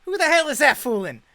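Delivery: high, loud scoff; scornful showman. Acted. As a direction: jab a finger and sneer loudly. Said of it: "He's like".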